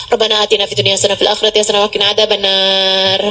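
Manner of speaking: rattled-off prayer. Shown as a demonstration. Preacher showing mothers a prayer not meant seriously, rattled off. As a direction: rush through the prayer by rote, low. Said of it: "Ini nih nggak serius doanya".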